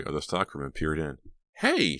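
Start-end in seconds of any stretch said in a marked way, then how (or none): 1.6-2.0 high, sharp cry of alarm. A narrator's reading. Reva finds two boys fighting, cries out alarmed. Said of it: "she cried in alarm".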